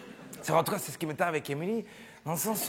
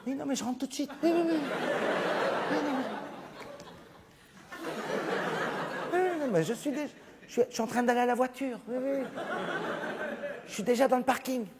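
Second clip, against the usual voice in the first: a little higher, guilty hurried phone voice; guilty guy on phone; 5 semitones higher, faster than usual. Retold or imitated. Un gars au bistrot répond à sa copine au téléphone, voix coupable et pressée. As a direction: reassure her you're already leaving. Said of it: "un des gars qui répond comme ça".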